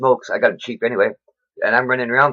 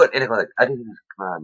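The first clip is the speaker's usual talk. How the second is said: ordinary delivery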